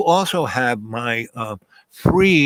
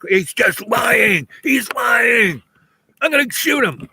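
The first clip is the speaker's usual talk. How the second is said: high, shouted rant that builds, slow at first; 10 semitones higher, slower than usual. Retold or imitated. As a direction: yell furiously about the lying driver. Man yells he will shoot.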